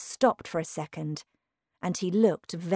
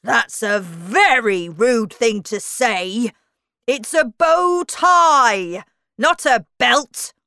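loud, slow, offended correction; angry; 4 semitones higher, 10 dB louder, slower than usual. A narrator's reading. Humpty Dumpty correcting Alice about his bow tie, angry and loud. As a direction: scold indignantly, climbing higher on the correction. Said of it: "said Humpty Dumpty angrily".

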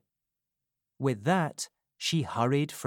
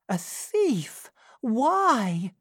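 alarmed Rose; 11 semitones higher, 4 dB louder, much slower than usual. A narrator's reading.

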